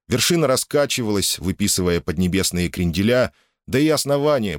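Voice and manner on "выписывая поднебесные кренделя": plain reading voice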